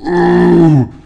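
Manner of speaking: high, loud, sustained "ooh"; drawn-out, high-pitched wooing sound; mock-spooky "ooh". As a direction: wail it like a theatrical ghost. Spooky noise after the paradox.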